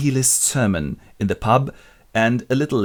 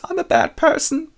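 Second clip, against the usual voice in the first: much higher whiny confession; high mock guilt; 4 dB louder than usual. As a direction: wail it like a guilty girl. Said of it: "bad conscience and goes".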